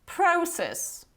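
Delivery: process in a British accent, long O as "PROH-sess"; British pronunciation. Shown as a demonstration. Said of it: "the proper British pronunciation will be".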